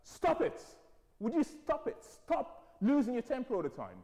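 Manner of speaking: high, exasperated telling-off; mum laying into him. Retold or imitated. Mum scolding her hot-tempered son, high and angry. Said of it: "she just laid into him".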